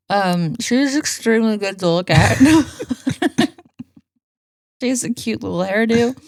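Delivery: talking around an ice cube; words slurred by ice in the mouth. Real, not acted. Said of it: "I just have ice in my mouth".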